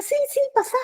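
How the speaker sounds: high, light clerk voice; friendly clerk. Retold or imitated. Travel agent welcomes the customer, high friendly voice.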